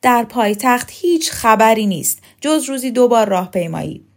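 loud, emphatic retort; shouting. A narrator's reading. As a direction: call it out forcefully. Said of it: "رکسانا فریاد زد". (با فریاد و تأکید)

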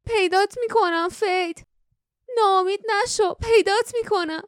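high-pitched, desperate calling; despairing cry. A narrator's reading. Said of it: "جاسمین با ناامیدی فریاد زد". (فریاد ناامیدانه)

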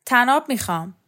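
loud, insistent call; shouting. A narrator's reading. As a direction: shout out a demand. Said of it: "داد زد".

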